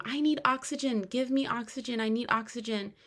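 high-pitched, soft mock begging; retold tearful plea. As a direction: imitate a panicked patient pleading for air. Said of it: "And I'm crying".

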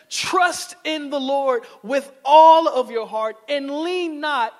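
slow, ringing proclamation; proclaimed scripture. Shown as a demonstration. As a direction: declare the verse with force. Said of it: "No, it says".

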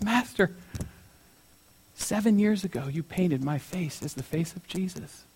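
soft, understated sorrow; mildly acted heartbreak, hushed; retold tearful reveal. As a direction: reveal it quietly, with long pauses. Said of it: "starts crying".

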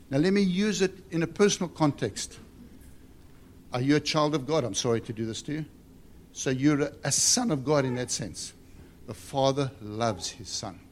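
ordinary delivery

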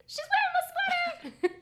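high, loud squealing laugh; excited squeal. Retold or imitated. Friend retelling her text, squealing with excitement. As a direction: squeal it out in delight. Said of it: "I was like".

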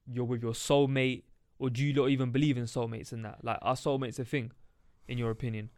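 normal talking voice

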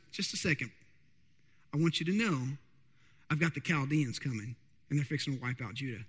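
low, soft divine reply; calm voice of God. Acted. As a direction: answer calmly as God, quiet and low. God answers the prophet.

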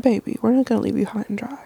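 breathy fast whisper. Acted. Cooing reassurance to listeners.

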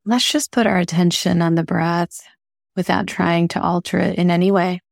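calm, even meditation voice with long pauses; unhurried guiding, leaving space between phrases; guided meditation. Acted. Host guiding listeners through breathing, calm meditation voice.

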